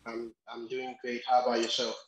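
usual speaking voice

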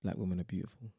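low-pitched spoken-word refrain. Acted. Closing own poem for a friend, deep recital. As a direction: drop the voice low for the poem's line. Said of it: "it's getting deeper and deeper".

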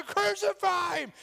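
very high, loud drawn-out shout; mob's roar. Acted. Crowd shouting Pilate down, roaring shout. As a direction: drown out the protest as the mob. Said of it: "they're going".

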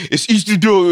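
"Isidro" lisped, high and loud; high-pitched lisping "It's Isidro". Retold or imitated. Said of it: "got the fucking lisp".

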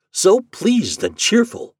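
high, slow, lilting contentment; pleased, drawn-out storybook voice; cheerful father. Acted. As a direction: linger happily on each word. Happy father at dinner.

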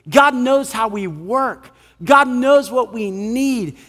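usual speaking voice

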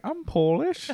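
squeaky high bad Polish accent attempt; mock Polish accent. Host playfully faking a Polish accent for friends, high and silly. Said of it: "I wish I could do, like, a Polish accent".

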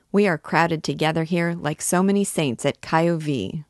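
ordinary delivery, nothing marked

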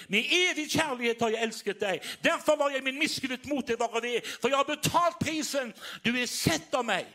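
preacher voicing the Lord to the congregation, fervent; preaching fervor. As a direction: proclaim God's love to the hall.

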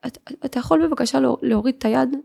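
retold timid request, hesitant and quiet at first; a frightened girl asking softly; quiet scared plea. Teen girl asks the taxi driver to remove his hand, quiet and afraid. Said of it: "בקולי בשקט ובפחד".

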